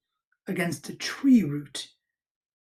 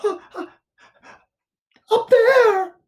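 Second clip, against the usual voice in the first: very high pants, then loud squeal; pant, then blurt it out in a squeak; breathless Piglet; 8 dB louder than usual. A narrator's reading. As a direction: panicky little piglet voice. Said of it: "said Piglet".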